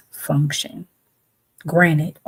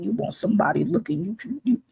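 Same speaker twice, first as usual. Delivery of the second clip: higher, softer and fast, teasing rise on looking; mocking singsong; 6 semitones higher, 4 dB quieter, faster than usual. Shown as a demonstration. Mocking busty women who want attention, teasing singsong. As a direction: tease it lightly like a taunt.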